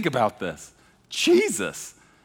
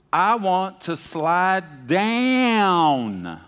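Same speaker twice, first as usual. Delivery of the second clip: put-on little kid's loud, drawn-out Texas drawl, higher pitch; thick Texas drawl; 6 semitones higher, 3 dB louder, much slower than usual. Toddler on backyard slide for dad's camera, exaggerated Texas drawl. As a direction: stretch every vowel like a small Texan child. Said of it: "just like that".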